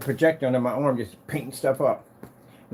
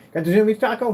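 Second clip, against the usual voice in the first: 1 talking to the cat; 8 semitones higher, 5 dB louder than usual. Real, not acted.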